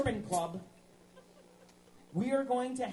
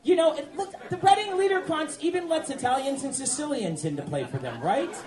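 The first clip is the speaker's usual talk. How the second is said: laughing through the words, getting louder and higher; real loud laughter, rising to a high shout; laughing loudly; 6 semitones higher, 6 dB louder than usual. Real, not acted. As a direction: laugh it out at the crowd. Laughing banter on stage.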